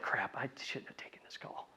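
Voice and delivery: whispered grumble to oneself; whispered regret. Retold or imitated. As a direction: hush it like private regret. Prospect's inner regret.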